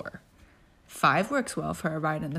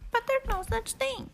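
very high small voice; small child voice. Acted. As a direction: answer like a sulky toddler. Pookie answers.

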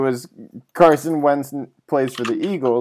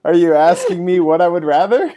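laughing; 6 semitones higher, 7 dB louder than usual. Real, not acted. Laughing at absurd question.

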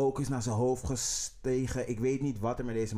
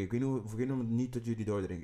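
normal talking voice

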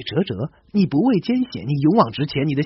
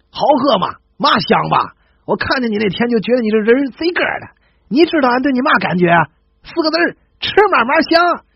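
put-on higher, louder, jovial Tianjin accent; 7 semitones higher, 5 dB louder than usual. A grinning Tianjin lad over tea woos a girl, higher and louder in a Tianjin accent. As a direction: beam and sell it like Tianjin street talk. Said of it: "正经人的朴实啊".

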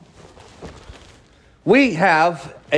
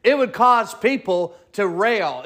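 ordinary talk

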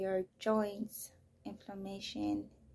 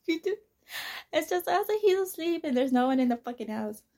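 loud, higher, laughing alarm; laughing disbelief; 7 semitones higher, 9 dB louder than usual. Retold or imitated. Alone at night with son asleep, hearing a door slam, laughing alarm. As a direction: laugh it off while spooked.

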